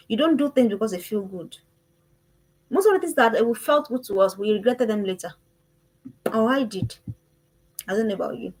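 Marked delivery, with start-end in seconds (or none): none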